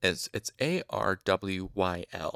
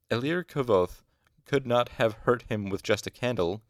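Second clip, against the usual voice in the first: ordinary delivery, nothing marked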